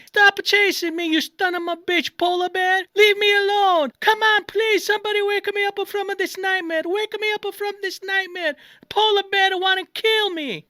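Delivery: high, hammed-up cries for help; acted panicked screaming. Dreamer fleeing a polar bear, high mock screaming. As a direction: plead in a mock terrified yell. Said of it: "and I'm screaming".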